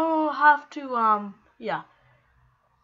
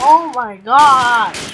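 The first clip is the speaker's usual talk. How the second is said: shocked exclamation; 13 dB louder, much slower than usual. Real, not acted. Shocked at the game.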